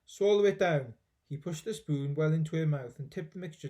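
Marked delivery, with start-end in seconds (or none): 0.1-1.0 eager command. Acted. George feeding medicine.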